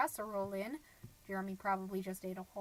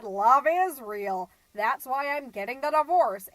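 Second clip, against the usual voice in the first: indignant retort; 10 dB louder than usual. Retold or imitated. Divorcing friend snapping back at book club, loud indignant retort. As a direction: fire back, hammering each word. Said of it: "And she said".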